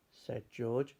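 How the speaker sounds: ordinary delivery, nothing marked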